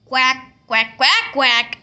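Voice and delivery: a duck's quacking. Acted. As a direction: quack quack in a duck voice. Duck quacking in story.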